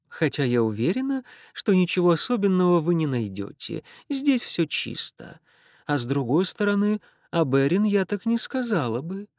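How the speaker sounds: lilting Irish, teasing; sly Irish woman's voice; Irish accent. Acted. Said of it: "сильный ирландский акцент".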